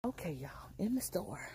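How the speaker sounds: hushed whisper on entering the store. Real, not acted. Vlogger whispers to viewers as she steps into the store. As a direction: whisper it like you're sneaking in.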